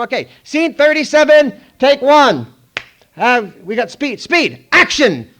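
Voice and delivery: high, loud clapper call; slate call. Retold or imitated. Clapper girl slates scene for the crew, loud high call. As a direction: call the slate across the set.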